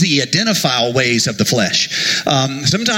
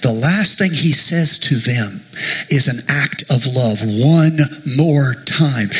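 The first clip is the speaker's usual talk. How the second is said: ordinary talk, nothing marked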